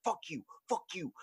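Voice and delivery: high, mock-aggressive dog cursing. Talking dog cursing its owner, mock aggressive. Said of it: "completely aggressive and extreme".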